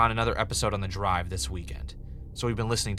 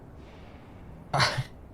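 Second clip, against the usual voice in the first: nervous laugh; 5 semitones higher, 6 dB quieter than usual. Acted. Awkward laugh at receptionist.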